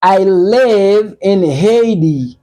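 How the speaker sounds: slow, carefully spaced English model; slow teacher's model sentence; slow clear demo. Shown as a demonstration. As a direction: say it slowly for learners. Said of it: "An nou fè yon fraz".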